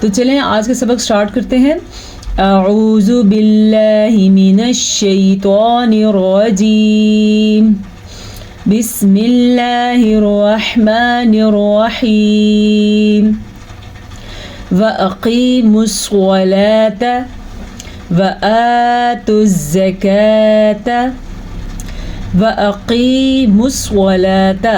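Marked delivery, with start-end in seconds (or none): 2.4-13.3 slow, measured tajweed recitation; Quran recitation. Acted. Teacher opening the Quran class, slow tajweed recitation. 14.7-24.7 slow tajweed chant with drawn-out long vowels; Quran recitation. Shown as a demonstration. Teacher modelling the verse for students, slow tajweed recitation.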